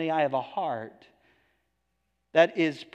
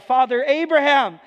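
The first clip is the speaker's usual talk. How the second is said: a higher, louder plea to Abraham; rich man crying out; 9 semitones higher, 8 dB louder than usual. Acted. Rich man in torment begging Father Abraham, raised cry. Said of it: "and he cries out".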